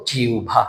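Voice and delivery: জিহ্বা said as jiubha; জিহ্বা with u and aspirated bh; জিহ্বা as jiu-bha; correct pronunciation. Shown as a demonstration. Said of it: "উচ্চারণ করতে গিয়ে আমরা বলবো".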